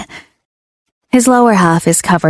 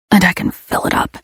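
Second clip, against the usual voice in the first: whisper; 4 dB quieter than usual. Acted. Lover whispers dirty promise.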